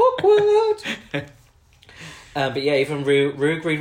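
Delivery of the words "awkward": sudden high loud squeal on the last word; squeaky punchline. Real, not acted. Host caps the joke about Sherry with a high, loud squeal. Punchline after friend laughs.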